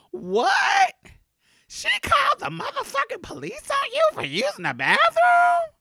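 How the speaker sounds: outraged friends shouting at the receptionist; friends going off. Retold or imitated. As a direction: yell it at her like you can't believe it. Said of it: "they just start going off on her".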